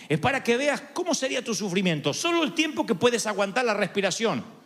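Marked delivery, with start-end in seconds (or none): none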